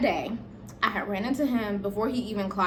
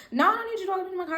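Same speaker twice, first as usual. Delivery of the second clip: fast stubborn brush-off; 9 semitones higher, much faster than usual. Retold or imitated.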